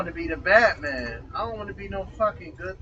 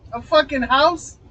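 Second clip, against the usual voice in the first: incredulous outburst; 7 semitones higher, 9 dB louder than usual. Real, not acted. Outraged at sister's claim.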